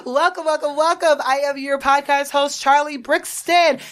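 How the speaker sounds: excited welcome. Acted. Hyped podcast host greeting.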